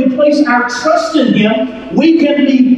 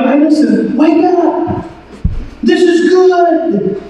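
raised pitch, climbing to the end; attention-getting; 6 semitones higher than usual. Acted. Preacher winning back drifted listeners, raised attention-getting call. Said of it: "to get your attention back".

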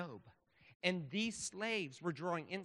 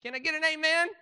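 high, loud Southern preacher's cry; Southern accent; 12 semitones higher, 11 dB louder than usual. Acted. Preacher calls on congregation for an amen, Southern accent. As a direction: whoop up the room like a Southern preacher. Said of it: "in a Southern accent".

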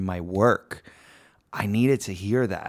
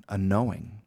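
usual speaking voice

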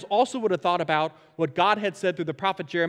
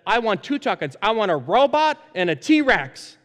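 louder, higher imitation of an eager kid; eager child's request; 5 semitones higher, 6 dB louder than usual. Noah asking dad for two tuck-ins, eager imitation. Voicing his excited young son.

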